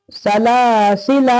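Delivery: "सलासिला" with long middle laa, short final la; recitation model. Shown as a demonstration. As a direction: hold the middle of "सलासिला", release the end. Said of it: "फिर क्या है?".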